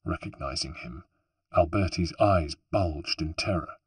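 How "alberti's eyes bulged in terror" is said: usual speaking voice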